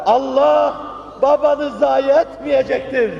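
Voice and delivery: high, loud, drawn-out declaration; impassioned proclamation. Acted. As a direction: proclaim it slowly at full force. Consolation repeated as an impassioned proclamation. (haykırarak, coşkuyla ilan)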